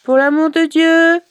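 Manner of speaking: high, loud, doleful wail of a beggar; plaintive beggar voice. Acted. Zalgoum at her brother's door, begging in a doleful wail. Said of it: "Elle fit sa voix dolente".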